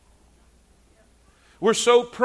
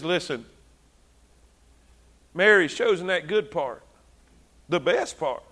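softer, lower, calm correction; gentle Jesus; 5 semitones lower, 5 dB quieter than usual. Retold or imitated. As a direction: settle her down kindly. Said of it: "And he says".

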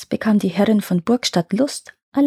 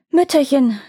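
high, falling call; a mild narrated cry for mother; 6 semitones higher than usual. A narrator's reading. Both daughters call after their vanished mother, mildly crying out. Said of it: "riefen beide aus".

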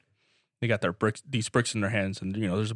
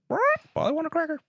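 squawky parrot, much higher and fast; faster than usual. Acted. Hidden parrot butting in on the captain, in a high fast parrot voice. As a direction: chirp it out like a talking parrot.

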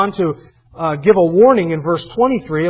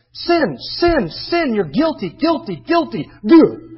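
higher, hammed-up chant of guilt; mock hellfire preacher; 7 semitones higher, 3 dB louder, slower than usual. Imitating Stephen hammering the crowd with their sin. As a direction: jab each word like a fiery preacher. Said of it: "All he preached was their sin".

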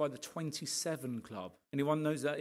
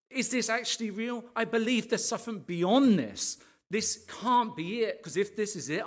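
high-pitched, louder, relentless rant; re-enacted rant; 6 semitones higher, 4 dB louder than usual. Man at coffee shop questioning life to his girlfriend, ranting. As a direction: rant on and on, raised in pitch and volume. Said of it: "I'd been ranting for about ten minutes".

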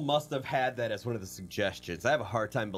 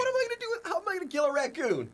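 high mock voice; faster than usual. Helpless girlfriend protesting.

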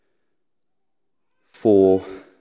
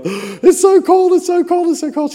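shivering whine; 9 dB louder than usual. Retold or imitated. Husband running down cold stairs, high shivering whine. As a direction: squeal as if freezing on the stairs. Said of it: "as I'm running down the stairs going".

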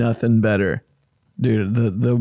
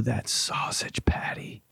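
savoring whisper; 10 dB quieter than usual. Real, not acted. Savoring remembered sausage.

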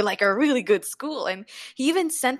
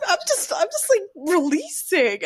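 laughing; 9 semitones higher than usual. Retold or imitated. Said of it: "I'm like".

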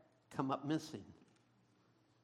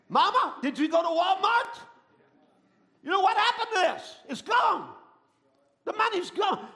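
alarmed family member; 8 semitones higher, 5 dB louder than usual. Acted. Alarmed family member finding money gone.

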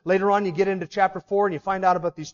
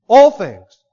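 "All" hit far louder; stress on ALL; 14 dB louder than usual. Shown as a demonstration. Preacher answers his own question, loud stress on "All". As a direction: shout out the word "All". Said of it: "How many things?".

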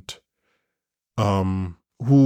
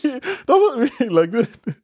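laughing; 14 semitones higher, slower than usual. Real, not acted. Laughing insistence.

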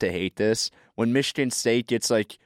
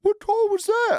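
high, loud mock outrage at the referee; outraged coach; 3 dB louder than usual. Imitating Bo Ryan protesting a bad call, high mock outrage. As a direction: throw your hands up and squeal the question. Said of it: "puts his hands up in the air and he's like".

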